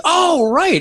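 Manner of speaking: high, drawn-out "oh" of realisation; retold delighted recognition; sudden recognition. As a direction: act out the penny dropping, pitch way up. Retells suddenly remembering the man.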